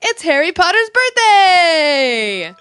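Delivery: loud, long-drawn celebratory cry; excited announcement. Real, not acted. Fan announcing a favorite character's birthday, loud drawn-out cheer. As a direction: proclaim it, stretching the last word out.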